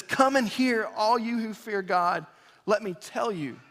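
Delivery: eager invitation to all who fear God; added excitement. Acted. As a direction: call out like sharing good news. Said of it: "added excitement now in the, in the tone of the psalmist".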